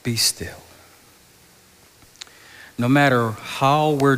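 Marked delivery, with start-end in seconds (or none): 0.0-0.6 whispered, calm command; hushed command. Retold or imitated. Jesus calming the waves, whispered command. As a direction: hush the storm with a whisper. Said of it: "Jesus just gets up and says".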